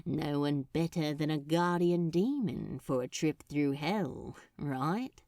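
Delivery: lightly voiced demon with a grin; a hint of smug demon teasing; grinning demon. A narrator's reading. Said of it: "grinned Crowley".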